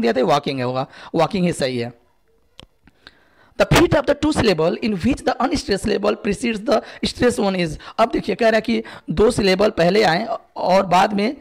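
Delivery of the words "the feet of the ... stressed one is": slow, careful quiz-question reading; slow dictation read. Acted. Teacher reading the exam question aloud to students, slow and careful. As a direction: spell it out slowly for the class.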